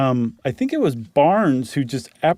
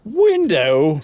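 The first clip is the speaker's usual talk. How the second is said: Meagle scoffing; 5 semitones higher, 3 dB louder than usual. Acted. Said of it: "said Meagle".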